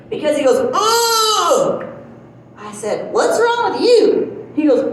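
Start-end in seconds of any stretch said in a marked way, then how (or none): 0.7-1.8 mock startled cry. Husband's sudden cry in lot. 3.1-4.5 mock alarmed question. Wife reacting to his cry.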